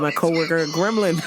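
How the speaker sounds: ordinary talk, nothing marked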